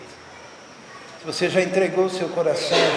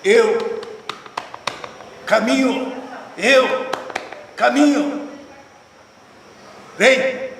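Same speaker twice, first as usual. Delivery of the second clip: a hint of a native guide, words set down one by one; halting guide's speech; 4 semitones higher, much slower than usual. Native guide leads lost singer, lightly voiced halting call. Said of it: "olhou pra ele e disse assim".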